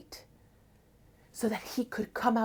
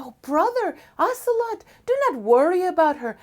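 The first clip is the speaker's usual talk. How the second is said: sisterly reassurance; 9 semitones higher, 5 dB louder than usual. Acted. Venus soothes her brother.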